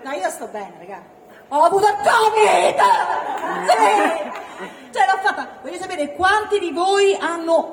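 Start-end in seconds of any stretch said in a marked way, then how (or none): none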